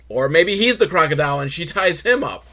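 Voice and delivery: usual speaking voice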